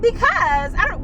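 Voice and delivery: high put-on sing-song; high sing-song she laughs at herself for; odd high voice. Real, not acted. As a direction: voice it high and silly. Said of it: "why am I talking like that".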